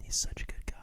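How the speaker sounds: hushed, whispered admiration; barely audible whisper. Pretend. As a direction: whisper it under your breath. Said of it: "We'll put on closed captioning".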